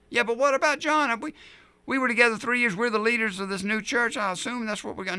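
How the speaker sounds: whining Peter. Retold or imitated. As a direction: push back like a jealous friend. Peter whining about John.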